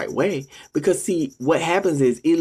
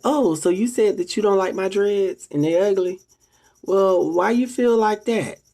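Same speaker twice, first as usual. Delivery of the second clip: higher, sugary innocent questioning; fake-sweet baiting; 7 semitones higher than usual. To a Bigo troll, baiting them in a higher, fake-innocent voice. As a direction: play dumb to lure the troll in. Luring a troll, acted exchange.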